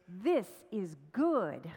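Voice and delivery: loud, slow, drawn-out GOOD; positive catchphrase. A narrator's reading. Storyteller voicing the king's ever-positive friend, emphatically upbeat. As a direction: proclaim it with slow, emphatic approval. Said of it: "His friend was a positive fellow".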